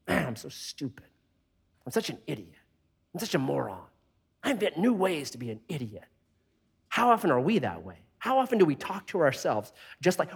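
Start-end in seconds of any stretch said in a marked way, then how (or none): 0.1-6.0 self-berating mutter. Retold or imitated. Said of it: "like that person talked to the checker".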